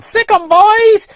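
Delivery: slow, high, loud retold yell; imitated yelling. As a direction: drag out the woman's call to her dogs, high and loud. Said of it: "She was yelling".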